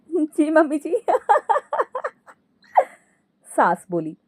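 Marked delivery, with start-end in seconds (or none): 0.0-1.1 high giggle, then cackling round the answer; laughing reply. Acted. Daughter-in-law answering mother-in-law's call, laughing. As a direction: answer a summons through acted laughter. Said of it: "हंसते हुए कहती है".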